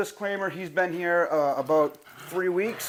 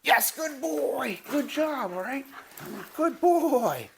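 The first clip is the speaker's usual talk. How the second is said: much higher, slow praise; puppy praise; 9 semitones higher, much slower than usual. Real, not acted. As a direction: coo happily at the dog.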